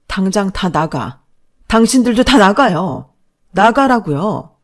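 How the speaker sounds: furious, slow, loud command to leave; shouting out. Acted. Wife orders her in-laws out of the apartment, shouting. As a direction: bark them all out of the house.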